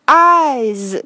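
"eyes" with a buzzing z ending; loud, stretched "eyes", voicing the final s. Shown as a demonstration. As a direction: clear demo of "eyes" ending in z. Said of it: "这个S也是念成Z这个音".

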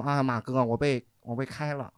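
soft, slow eunuch voice; lightly voiced eunuch. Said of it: "你这是太监还是什么？".

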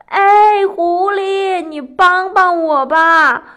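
loud, high, drawn-out shout for help; loud call. A narrator's reading. Rabbit hailing the passing fox for help, loud call. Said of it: "班尼兔大喊道".

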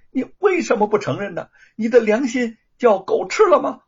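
loud, high, trembling-with-rage tirade; furious outburst. Acted. Wu Chengjia defends her brother, shaking with rage. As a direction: tear into the liar for slandering her brother. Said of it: "吴承家气得发抖".

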